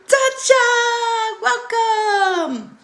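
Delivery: high, loud, sung welcome; sing-song greeting. Real, not acted. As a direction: sing the name out high and bright. Sing-song greeting to live viewer.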